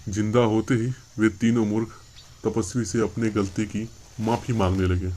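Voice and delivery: ordinary delivery, nothing marked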